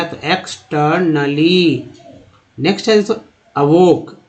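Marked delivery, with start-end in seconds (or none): 0.2-1.8 "एक्सटर्नली" drawn out as eks-tur-na-lee; slow recap of "एक्सटर्नली"; model pronunciation. Shown as a demonstration. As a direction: stretch "एक्सटर्नली" syllable by syllable. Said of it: "टी लगा टर हो गया".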